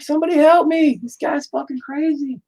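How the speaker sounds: very high, squeaky pretend cry for help; mock panic. Cloud cries for help.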